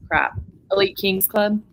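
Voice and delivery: ordinary talk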